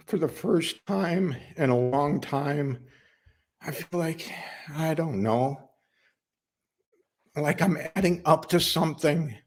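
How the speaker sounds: halting, heartfelt plea, often lifting in pitch; earnest working man's appeal. Acted. Worker asks boss Darla for compassion, earnest and halting. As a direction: search for the words as you ask for compassion.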